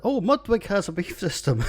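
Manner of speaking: corrected reading with a laugh; laughing realisation. Real, not acted. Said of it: "I can't read my hashtag".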